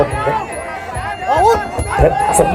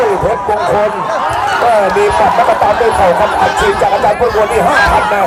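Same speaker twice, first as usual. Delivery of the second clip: excited boxing commentary; 6 semitones higher than usual. Real, not acted. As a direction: yell the knee strike as it lands. Live call of knee strike.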